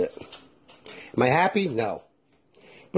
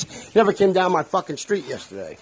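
normal talking voice

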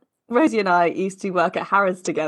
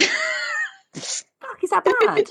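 shaky attempt at Glaswegian accent; a Glasgow accent, unsure; 10 semitones higher, slower than usual. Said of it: "doing Glaswegian".

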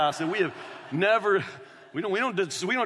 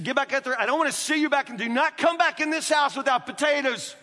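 raised, loud scolding mother; a mother ordering the kids back out, raised and loud; mom's angry order; 7 semitones higher, 3 dB louder than usual. Retold or imitated. Mother sending kids back to the garden, loud angry order. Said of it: "she said".

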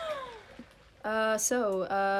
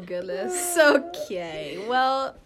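normal talking voice

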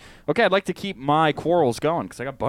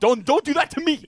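high whiny plea; 10 semitones higher than usual. Real, not acted. Pleading in mock quarrel.

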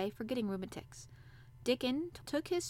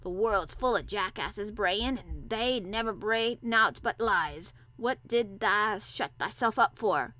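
slow, loud, scornful dialect; blunt old countryman, heavily drawn out; gruff old Yorkshire gardener; 6 dB louder, slower than usual. A narrator's reading. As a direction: grumble about fools in broad Yorkshire.

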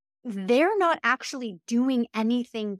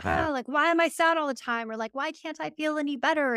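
higher, whiny mock lament of a stuck mind; despairing self-talk; 6 semitones higher than usual. A person in a downward spiral asking why they're still sad, mock whiny. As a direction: voice the sad loop of self-pity, pitched up.

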